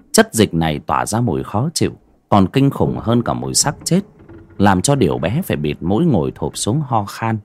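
ordinary delivery

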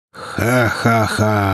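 slow, hollow villain laugh; sinister laugh. Acted. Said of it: "прозвучал его страшный зловещий смех".

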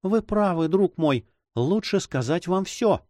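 high-pitched woman's voice, giving in; resigned heroine. Acted. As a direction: play a lady deciding to confess. Heroine gives in to lover.

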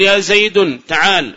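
"يا زيد" slowly with an -un ending on زيد; wrong tanween. Shown as a demonstration. Teacher shows students the faulty call, "زيد" said Zaydun. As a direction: sound a clear n: "زيد" as Zaydun. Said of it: "هذا لحن".

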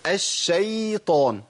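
الشيطان with the ya stretched very long like a madd; wrong lengthened ya. Shown as a demonstration. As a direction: drag out the ya in الشيطان slowly. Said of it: "ما ينفعش". (الشيطان بمد الياء خطأ)

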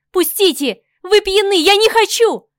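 high, loud desperate cries; panicked screaming. A narrator's reading. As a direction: scream in terror. Woman fighting off attackers.

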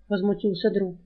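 ordinary delivery, nothing marked

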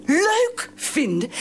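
slow, drawn-out disbelief; incredulous scorn. Acted. Wife scoffs slowly at husband's excuse. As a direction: repeat it slowly, mocking the idea.

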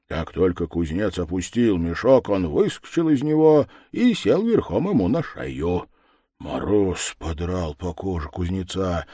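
ordinary delivery, nothing marked

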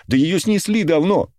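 high, indignant retort; angry. A narrator's reading. Girl insisting the hotel was demolished, angry. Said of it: "рассердилась девушка".

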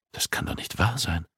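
plain reading voice

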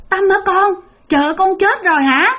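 put-on mother learning the girl is dead, stunned; shocked mother. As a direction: gasp it out, hearing the girl is dead.

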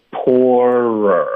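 "poorer" said slowly, with a second R syllable held at the end; drawn-out R sound. Shown as a demonstration. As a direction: stretch "poorer" into two syllables, POOR-rer. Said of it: "然后我们需要再发一个R".

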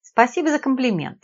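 plain reading voice

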